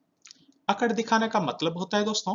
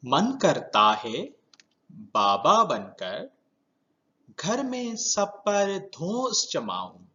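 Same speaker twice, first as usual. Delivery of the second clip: slow verse reading with rising, swooping phrase ends; measured, lilting rhyme for children; poem recitation; 3 semitones higher, slower than usual. Shown as a demonstration. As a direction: recite it in short phrases, pausing between them. Reciting the next verse to class.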